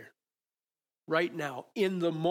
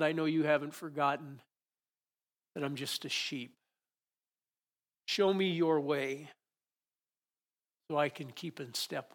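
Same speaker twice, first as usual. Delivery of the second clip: prayer. Acted. Opening prayer, soft and slow.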